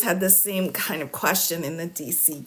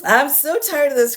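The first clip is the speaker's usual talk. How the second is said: higher, louder, fed-up groan of a line; exasperated; 7 semitones higher, 5 dB louder than usual. Real, not acted. Commentator fed up with the defense motions. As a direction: sound worn out and amused.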